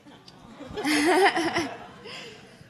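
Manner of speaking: high, loud burst of real laughter. Real, not acted. Guest reacting to a five-year-old's question, laughing loudly.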